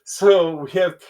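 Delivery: talking into a rising yawn; real yawn breaking into speech. Real, not acted. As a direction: try to speak as a yawn takes over. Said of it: "I'm gonna yawn".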